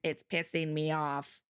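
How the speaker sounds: slow, low deadpan imitation; mock annoyed. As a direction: grumble it slowly, low and deadpan. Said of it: "Grace out here being like".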